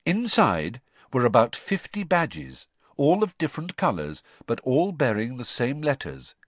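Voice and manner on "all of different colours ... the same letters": plain reading voice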